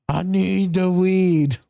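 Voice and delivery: slow, high mock plea for weed; mocking whine. Mimicking client back, mocking.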